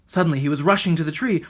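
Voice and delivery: ordinary delivery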